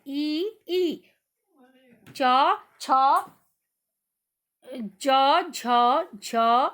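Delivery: palatal letters च, छ, ज, झ spoken singly and firmly; sound demo. Shown as a demonstration. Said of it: "आप इन्हीं शब्दों को बोल के देखना".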